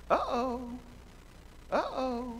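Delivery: high, teasing caught-out uh-oh; playful uh-oh. Acted. Preacher reacting to the child's question, teasing uh-oh. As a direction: sing it like you got caught. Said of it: "reacting to kid's question".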